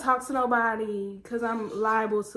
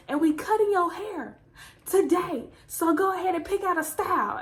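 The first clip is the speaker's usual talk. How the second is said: higher, matter-of-fact mom voice; mom's announcement; 7 semitones higher than usual. Retold or imitated. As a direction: lay down the law like mom.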